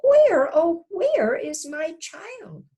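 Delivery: put-on a shocked mother realizing her child is gone; startled mother. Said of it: "turned around and gasped".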